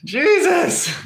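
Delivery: laughing. Real, not acted. Streamer cracking up at chat.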